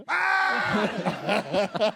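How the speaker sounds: loud, high comic scream; mock scream. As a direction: shriek like a startled trick-or-treater. Children scream at Stupid Death.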